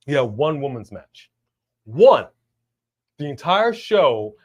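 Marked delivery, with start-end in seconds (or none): 1.9-2.3 "One" hart und laut gesetzt; emphatic "One". Real, not acted. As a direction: punch "One" as a single loud word. Stresses only one women's match.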